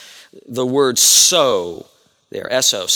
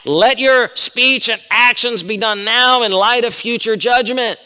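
raised pitch, slow and forceful; emphatic; 6 semitones higher, 3 dB louder than usual. Shown as a demonstration. Preacher renders the verse as an urgent command, emphatic. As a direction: drive home "speech and actions" word by word, high and slow. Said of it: "It's emphatic".

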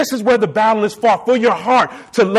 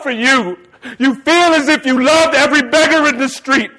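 emotional reading; 3 semitones higher than usual. Real, not acted. Preacher overcome while reading Spurgeon to the church, tearful. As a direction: voice breaking as he reads. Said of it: "Y'all got to excuse me".

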